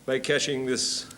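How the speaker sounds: put-on hammy old thespian asking a favour; old touring actor. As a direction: play an old-school actor announcing himself. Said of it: "a story about an old boy who's on tour".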